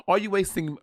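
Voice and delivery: a light put-on British accent in an ad read; mock British accent. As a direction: hams up a British voice, softly. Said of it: "I'll do British show".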